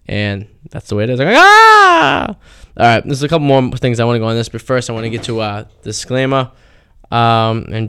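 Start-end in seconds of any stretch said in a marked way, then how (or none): 1.4-2.4 high shrill mock scream; little girl scream. A kidnap victim screaming like a little girl, high mock scream. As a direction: squeal like a scared little girl. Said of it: "I'm gonna scream like a little girl".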